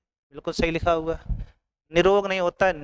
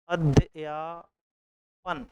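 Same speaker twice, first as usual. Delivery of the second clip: slow spelled-out word; 3 dB quieter, much slower than usual. Shown as a demonstration. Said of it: "तो अध्यापन".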